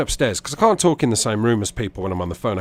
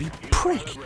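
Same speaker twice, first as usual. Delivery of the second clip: high-pitched indignant fuming; enraged muttering; 10 semitones higher, slower than usual. Retold or imitated. Walking round the room raging, indignant muttering to self. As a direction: re-enact fuming to oneself, voice pitched right up. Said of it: "I was there muttering to myself".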